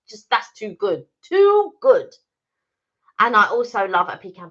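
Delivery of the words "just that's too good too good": delighted. Real, not acted. Savouring almond croissant.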